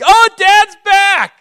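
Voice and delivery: put-on overjoyed child welcoming dad home; kids' joyful greeting. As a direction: squeal it like a kid thrilled to see dad.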